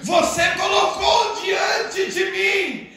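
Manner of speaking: forceful proclamation. Acted. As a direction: proclaim God's word with mounting force. Preacher voicing God's word with force.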